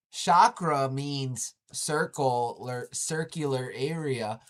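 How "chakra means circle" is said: Chakra said slow and loud, like a teacher; slow emphatic explanation. Shown as a demonstration. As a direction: spell it out slowly so they hear "Chakra". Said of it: "Chakra sounds like circle".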